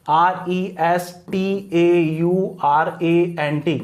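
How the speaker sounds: very slow, separated letters; measured classroom spelling; spelled out slowly. Shown as a demonstration. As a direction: dictate it one letter at a time. Spelling out word for students.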